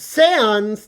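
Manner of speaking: excited exclaim. Acted. Excited reveal of a game character.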